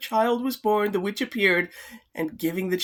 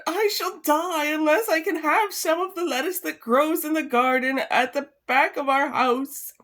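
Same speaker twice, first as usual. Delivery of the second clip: pleading wife; 6 semitones higher than usual. Acted.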